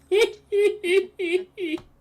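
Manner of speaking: high, loud giggling cackle; goblin giggle. Acted. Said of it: "Starts giggling away to himself".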